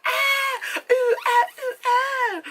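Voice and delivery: very high, hammed-up moaning; mock moans. Sister in the ice cream scene, high moaning. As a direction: overdo the cries of pleasure. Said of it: "And she's like".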